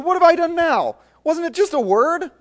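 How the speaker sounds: very high, loud exasperated cry; indignant protest. Acted. David protests to his angry brother, exasperated. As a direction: whine of wounded innocence.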